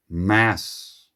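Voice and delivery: "Mass" set apart as one weighty word; emphatic MASS. Real, not acted. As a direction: land firmly on "Mass", standing alone. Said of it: "I want to emphasize the second word".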